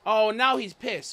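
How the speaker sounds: ordinary talk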